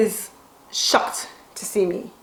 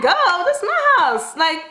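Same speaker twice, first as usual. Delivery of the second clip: panicked quote; 8 semitones higher than usual. Retold or imitated. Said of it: "I'm like".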